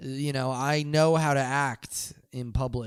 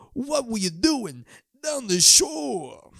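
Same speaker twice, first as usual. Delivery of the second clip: much higher, slow drawn-out yell; mock shout; 10 semitones higher, 4 dB louder, much slower than usual. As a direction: stretch the question out like a stadium call. Brother to brother, calling out.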